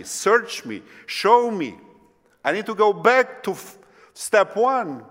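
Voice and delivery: loud, high, urgent cry to God; impassioned plea. Acted. Preacher crying out to God to search him, impassioned plea.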